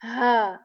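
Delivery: Ha said with a firm clear H like English. Shown as a demonstration. Teacher modeling the clear ha letter. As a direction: give Ha a plain crisp H. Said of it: "This is a very clear H sound".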